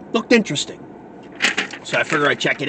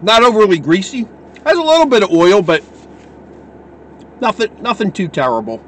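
talking between bites of pizza; chewing while talking; 3 semitones higher, 3 dB louder than usual. Real, not acted. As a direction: mouth partly full of food. Seen 1: bites pizza at 0.5 and 3.3, mouth working between.

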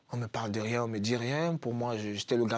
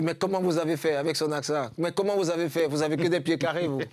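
raised, louder mock-incredulous coach; teasing South American coach; 4 semitones higher, 4 dB louder than usual. Coach teasing Eric about his teammate, mock incredulous. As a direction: tease him like a hot-blooded coach. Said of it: "Il est sud-américain".

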